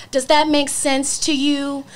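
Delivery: slow, patronizing question, mock imitation; condescending. Mocking the man explaining Airbnb, slow and condescending. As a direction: talk down to her like she's five. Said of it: "in a really condescending way".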